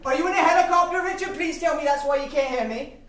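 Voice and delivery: yelling into phone. Acted. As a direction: shout so he can hear you.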